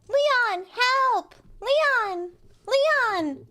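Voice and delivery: loud, high mock cry for help; calling out. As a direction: yell for Leon to save you.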